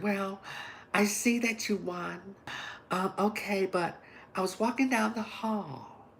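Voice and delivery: slow, soft, solemn teacher voice; teacher's prim voice. Retold or imitated. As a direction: imitate a prim, hushed teacher talking down to a student. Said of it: "That's how she talks".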